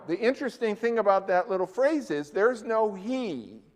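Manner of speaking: ordinary talk, nothing marked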